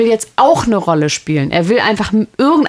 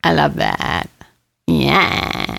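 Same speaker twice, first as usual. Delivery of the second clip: vocal fry; 4 semitones lower, 3 dB quieter, much slower than usual. Shown as a demonstration. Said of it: "ich kann's jetzt nicht so wahnsinnig gut".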